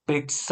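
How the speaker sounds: પિટ્સ said as "pit-saa"; wrong vowel ending. Shown as a demonstration. Teacher warning pupils, પિટ્સ with a long "saa" ending. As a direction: drag a vowel onto the end of પિટ્સ. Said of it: "નથી કહેવાનું".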